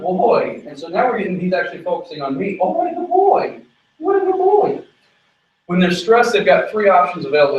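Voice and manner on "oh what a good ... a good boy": much higher, bright sing-song praise; high-pitched doggy praise; praising the dog. Real, not acted. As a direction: gush over the dog in a high voice. Praising the dog mid-training.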